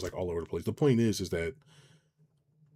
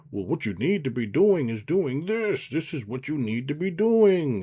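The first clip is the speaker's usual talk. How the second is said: high, slow preachy boomer voice; grumbling out of touch boomer; 7 semitones higher, slower than usual. Retold or imitated. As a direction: lecture like a smug old boomer. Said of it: "these boomer podcasts".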